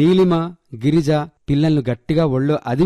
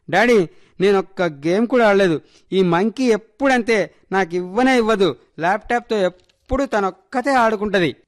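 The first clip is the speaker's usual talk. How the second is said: high-pitched tattling to daddy; complaining child; 6 semitones higher than usual. Acted. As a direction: protest to dad in an aggrieved child's voice. (నాన్నకు ఫిర్యాదు చేసే పిల్ల గొంతు)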